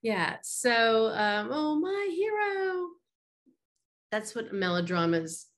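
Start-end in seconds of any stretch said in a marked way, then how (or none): 1.5-2.9 high, slow, swooning damsel voice. Acted. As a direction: sigh it out like a rescued heroine. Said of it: "damsel swoons at hero".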